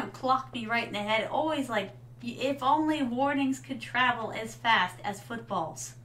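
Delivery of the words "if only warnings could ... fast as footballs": ordinary delivery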